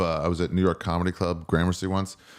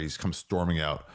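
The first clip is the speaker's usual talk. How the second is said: usual speaking voice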